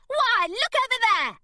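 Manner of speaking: high, loud cry of surprise; excited cry. Acted. Girl crying out to distract the secretary, mock startled. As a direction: fake a startled cry to draw his eye. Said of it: "she cried".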